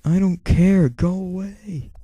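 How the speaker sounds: high, drawn-out imitation of an annoyed girl; mock brush-off. Imitating the girl refusing to start over, mock brush-off. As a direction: brush them off in a high mock voice, stretching the end. Acts out her angry brush-off.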